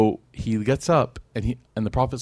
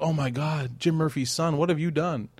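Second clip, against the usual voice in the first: scandalised gasping neighbours; 5 semitones higher, 3 dB quieter than usual. Retold or imitated. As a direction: imitate neighbours shocked at a family's disgrace. Acts out scandalised gossiping neighbours.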